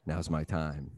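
low, soft, dramatic resolve; quiet resolve. Retold or imitated. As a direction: say it hushed like a hero. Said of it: "And I go".

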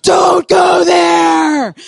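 slow, loud booming warning; warning shout. Pretend. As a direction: boom it out like a guardian voice. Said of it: "it's like".

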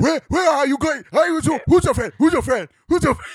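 put-on high, loud caricatured African accent; bad African accent. Host mocking an actor's film scene, bad African accent. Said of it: "Every time he put on that accent".

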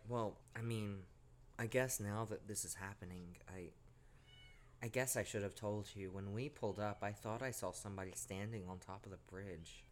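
sheepish quiet confession. Retold or imitated. Friend's hesitant confession voiced.

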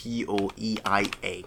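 spelling "Onomatopoeia" letter by letter, slowly; spelled out. Shown as a demonstration. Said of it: "I'm gonna spell it real quick".

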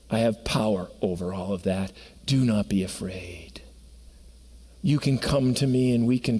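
tender Jesus voice promising mercy; gentle reassurance. Acted. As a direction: reassure a frightened friend. Jesus speaking, soft and reassuring.

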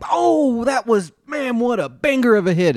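high, loud, thrilled fan shout; excited cheering. Retold or imitated. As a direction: cheer it like a big hit. Mock excited tennis fan.

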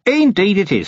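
a cheerful, long-winded hermit eager to explain; chatty old hermit. Acted. Hermit speaks.

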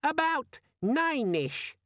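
very high, irritatingly friendly squeak; squeaky friendly demon. A narrator's reading. Pocket demon telling Vimes the time, squeaky and friendly. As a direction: chirp it sweetly in a squeaky voice. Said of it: "had the squeaky, friendly quality".

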